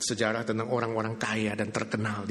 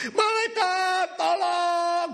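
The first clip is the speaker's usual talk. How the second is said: much higher, louder desperate shout; screaming for the angel; 4 dB louder, much slower than usual. Acted. Wife screaming for the angel to save her, high loud shout. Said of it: "dia teriak malaikat".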